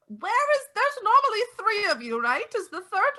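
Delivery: put-on high, sweet old neighbour; pry politely like a kindly old neighbour; old neighbour quizzing the vampires, high and sweet; old lady voice.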